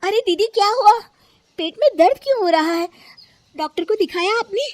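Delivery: high, loud, worried questions; alarmed concern. Acted. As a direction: ask urgently what is wrong. Finds sister-in-law in pain.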